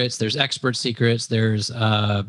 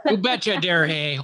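upbeat Wisconsin accent, raised pitch; chirpy Upper Midwest Wisconsin twang; 5 semitones higher than usual. Real, not acted. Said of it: "There goes my Wisconsin accent".

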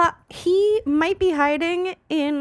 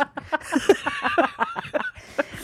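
high shrieking laugh. Real, not acted. Big laugh.

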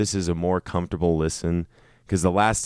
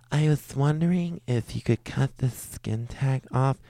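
higher, softer timid mumble; impression of guest; 7 semitones higher, 4 dB quieter than usual. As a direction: imitate a shy quiet guest asking a favour. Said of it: "that is a great impression".

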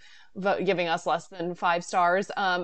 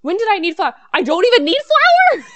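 higher, louder exasperated cry; flustered cook; 12 semitones higher, 10 dB louder than usual. Retold or imitated. Jennifer Garner cooking on video, high exasperated cry. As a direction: toss it off in comic frustration. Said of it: "She was like".

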